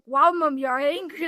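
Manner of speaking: loud, high, thrilled exclamation; delighted. Retold or imitated. Daughter thrilled at mom's anger, loud and delighted. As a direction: replay the joy of finally seeing her mad. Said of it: "And I was so happy".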